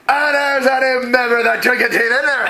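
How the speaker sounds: high, loud rowdy drinking-buddy cheer; boisterous announcement. Acted. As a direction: hail the table like a drunk toastmaster. Rowdy NPC announcing at table.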